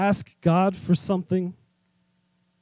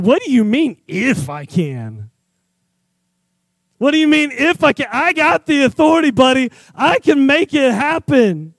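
confident Jesus; 5 semitones higher, 4 dB louder than usual. Acted. Jesus confronting the doubting father.

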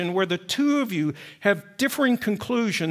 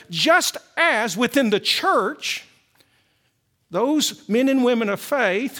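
louder, higher building sermon; preaching; 4 semitones higher, 3 dB louder than usual. Acted. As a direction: drive the argument to its peak. Pastor builds to climax.

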